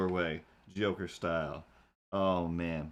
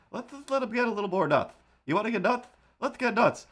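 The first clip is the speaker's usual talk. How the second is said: much higher, faster squeaky voice; squeaky get nuts; 12 semitones higher, faster than usual. Pretend. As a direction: squeal it way up high and quick. Goofy playful "get nuts" bit.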